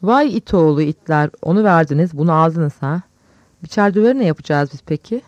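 big, loud outburst; loud exclamation. A narrator's reading. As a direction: burst out at full voice. Director bursts out at the swap. (yüksek sesle çıkışma)

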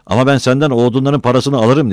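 high, loud defiant yell; shouted threat. A narrator's reading. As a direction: shout a parting threat through the door. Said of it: "diye bağırdı".

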